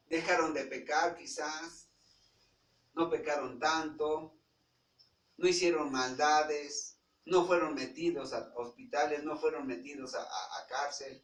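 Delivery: loud preaching, a steady build of emphasis; fiery preaching. Acted. Preacher rousing the congregation about lost relatives, loud preaching. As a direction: drive each point home to the congregation.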